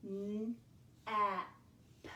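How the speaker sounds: ordinary delivery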